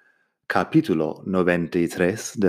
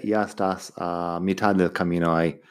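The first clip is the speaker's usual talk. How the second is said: normal talking voice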